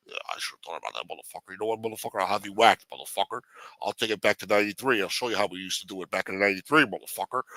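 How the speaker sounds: put-on low rasping old mafia boss; low mob boss. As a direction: whisper threats like an aging don. Mob boss answers threatening.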